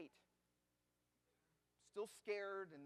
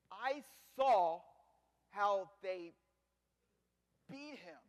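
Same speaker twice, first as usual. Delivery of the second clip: anguished; 11 dB louder, slower than usual. Acted. Recalling the crucifixion, anguished.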